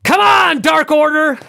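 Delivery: high, loud screamed cheer; Chris Jericho. Retold or imitated. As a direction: scream it out like an over-keen heel fan. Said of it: "and he screams".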